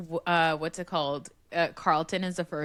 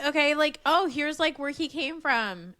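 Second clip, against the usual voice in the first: higher, pushy explaining voice; mock yourself over-explaining; eager parent; 8 semitones higher than usual. Mother imitating herself explaining to her kids. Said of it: "I was like".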